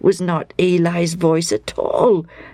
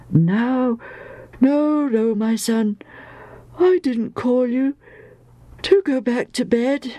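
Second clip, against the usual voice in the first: higher, put-on old man's voice; gently puzzled old father, pitched up; old priest Eli; 7 semitones higher than usual. A narrator's reading. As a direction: play an elderly priest sending a child off.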